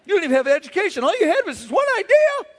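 high, fast mocking taunt; taunting pride. Pride taunting the speaker after the meeting, high mock voice. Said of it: "My pride's like".

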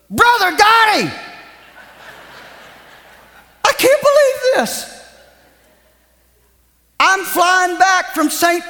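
high, eager retelling of the traveler; breathless disbelief of a stranger; excited man retold. Preacher voicing an excited stranger at the airport.

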